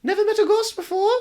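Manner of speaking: put-on high teasing ghost girl; high playful ghost girl. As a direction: tease him as a giggly ghost. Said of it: "She laughs".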